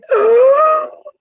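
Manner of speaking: loud high gasp; shocked gasp. Retold or imitated. Mother-in-law gasping at the toddler's outburst. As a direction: gasp in scandalized horror.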